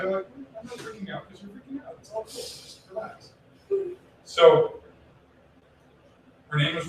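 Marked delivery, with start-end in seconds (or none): 0.4-3.3 mock calm. Mimics maddeningly calm partner.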